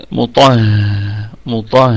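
مطه dragged very slowly, the ta heavy; slow drill. Shown as a demonstration. Teacher drills the heavy ta in مطه, demonstration. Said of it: "هكذا".

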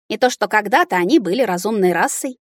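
ordinary delivery, nothing marked

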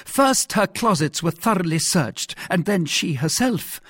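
high, insistent royal voice; mildly choleric king. A narrator's reading. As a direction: lay down the case with some anger. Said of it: "in the highest state of choler".

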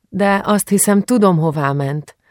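a lightly voiced daughter, unsure but hinting; young girl's hesitant guess. A narrator's reading. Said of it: "vágott a szavába apus".

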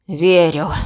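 low, drawn out and beaten. Acted. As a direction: stretch it out, defeated. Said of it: "протянул Виктор, потирая грудь".

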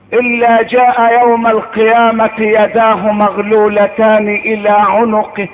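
normal talking voice

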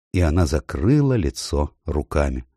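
usual speaking voice

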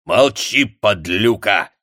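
loud, slow furious bark. A narrator's reading. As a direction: bark her into silence. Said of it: "рявкнул Коваль".

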